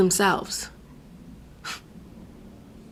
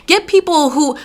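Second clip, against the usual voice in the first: ordinary talk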